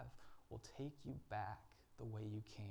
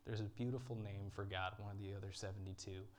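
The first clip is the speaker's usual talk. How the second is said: usual speaking voice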